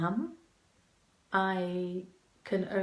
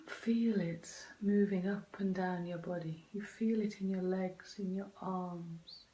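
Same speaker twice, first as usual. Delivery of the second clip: guided meditation. Acted. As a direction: gently guide them into calm. Continues guided inner journey.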